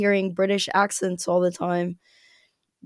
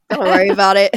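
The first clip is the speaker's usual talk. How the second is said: laughing; 7 dB louder, much faster than usual. Real, not acted. Repeats advice, laughing.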